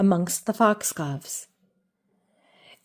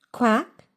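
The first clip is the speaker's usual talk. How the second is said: short rising quack; a curious little quack; duck quack; 4 semitones higher than usual. Acted. Jemima startled by the gentleman, questioning quack.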